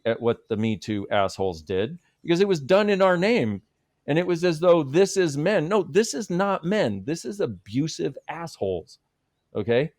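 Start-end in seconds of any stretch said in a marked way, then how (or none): 2.2-9.0 high, heated indignation; indignant. Real, not acted. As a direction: push back hard, voice climbing. Indignant rant about abusers.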